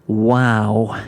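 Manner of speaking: a mild surprised wow; a touch of startled disbelief; understated surprise at her sister; taken aback. Acted. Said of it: "taken aback".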